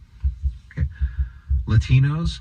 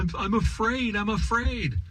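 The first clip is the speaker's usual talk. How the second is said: higher, softer mock scared whine; imitating a frightened student, hammed-up; mock fearful whimper; 8 semitones higher, 5 dB quieter than usual. Mocking scared students on campus, hammed-up fearful whimper. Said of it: "people out there right now crying in college campuses, going".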